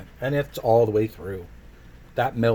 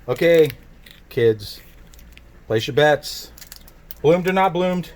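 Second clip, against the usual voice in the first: game show host; 4 semitones higher, 9 dB louder, slower than usual. Pretend. Game-show style betting call.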